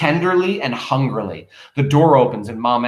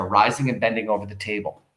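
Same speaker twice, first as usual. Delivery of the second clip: ordinary delivery, nothing marked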